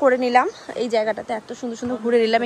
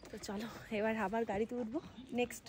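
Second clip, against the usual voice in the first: talking softly; 15 dB quieter than usual. Real, not acted. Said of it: "তাই আস্তে আস্তে কথা বলছি".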